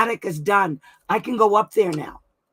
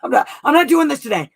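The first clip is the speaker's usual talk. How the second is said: high, loud mock yell at a friend; 8 semitones higher, 9 dB louder than usual. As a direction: snap back as if annoyed, played for laughs. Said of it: "Sometimes she yells back".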